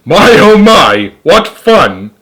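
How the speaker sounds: loud, high, drawn-out delight; gleeful yell. Acted. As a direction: yell it slowly with relish. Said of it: "and yelling".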